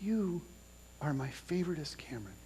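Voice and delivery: soft breathy whisper close to his face. Retold or imitated. Father whispering goodnight to his drowsy son. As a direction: whisper it right in front of a sleepy face. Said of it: "and I whispered".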